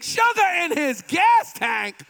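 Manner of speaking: high, loud wicked delight; gleeful confession. Acted. Preacher voicing a vengeful ex bragging, gleeful and loud. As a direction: brag about the revenge.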